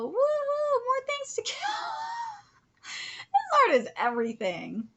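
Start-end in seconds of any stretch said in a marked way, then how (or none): 0.0-1.6 high, loud gleeful cheer; imagined soldier cheering for more killing; mock warmonger whoop. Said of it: "I thought they'd be all". 1.6-2.4 maniacal laugh. Retold or imitated. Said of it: "pure warmongers".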